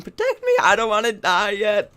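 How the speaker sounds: a tiny panicked unit pleading to be saved; pleading Baneling. Pretend. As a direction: voice the Baneling begging the Queen for protection. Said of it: "asking the Queen".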